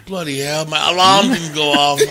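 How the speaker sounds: put-on English accent. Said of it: "getting the English accent down".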